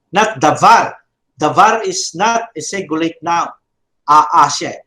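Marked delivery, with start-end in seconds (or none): none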